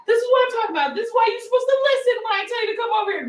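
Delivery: high, quick scolding mother; mom lecturing. Retold or imitated. Mother scolding her son in the aisle, high and fast. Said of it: "she was like".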